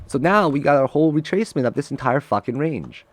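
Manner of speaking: normal talking voice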